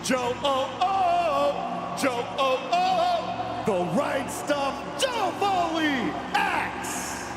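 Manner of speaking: big booming arena intro; hype announcer. Real, not acted. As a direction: pump up the crowd. Arena announcer hyping a driver.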